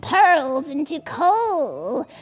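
high, slow witchy threat; witch voice. Acted. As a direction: cackle out the warning like a witch.

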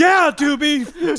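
loud, high, drawn-out stoner call; stoner dude shouting. Acted. Stoner calling to his buddy, loud mock despair. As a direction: stretch it out slowly, rising at the end. Stoner buyers bit continues.

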